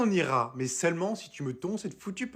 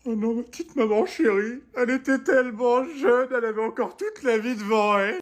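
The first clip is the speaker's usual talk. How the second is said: higher, louder mock wailing over her mother; hammed-up grief; 8 semitones higher, 3 dB louder than usual. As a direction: overplay the grief, wailing and high. Wife mourning her mother.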